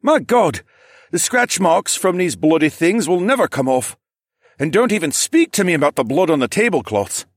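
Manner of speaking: high, loud fussy complaint; Gerald groaning in dismay. Acted. Gerald laments the bloody mess left by the dinos, loud dismay. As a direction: bemoan the damage, high and forceful.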